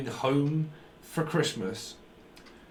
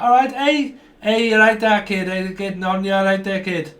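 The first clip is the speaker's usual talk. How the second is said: put-on loud, high "hey, you right there, kid"; calling out; 4 semitones higher, 6 dB louder than usual. Calling to a kid who's getting on, loud. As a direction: call across to the kid.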